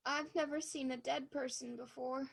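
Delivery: stunned and quiet. Acted. Shaken girl after finding body.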